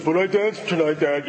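lightly voiced excited little girl; a hint of a proud young daughter; imitating his daughter. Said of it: "talking like this".

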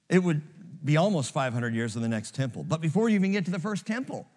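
ordinary talk

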